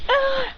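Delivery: high, drawn-out mock scream. Bullies sent flying by the kick, high mock scream. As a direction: scream high without real volume. Trapped attackers cry out.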